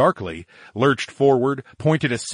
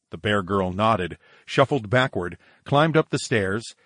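ordinary delivery, nothing marked

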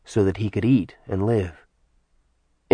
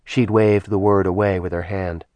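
ordinary delivery, nothing marked

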